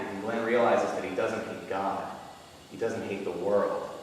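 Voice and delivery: soft, low narration fading out; quiet, heavy confession; somber realization. A narrator's reading. Boy's painful realization in story.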